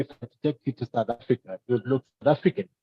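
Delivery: ordinary delivery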